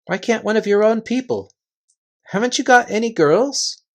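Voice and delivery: Lucy, anxious. Acted. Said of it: "asked Lucy".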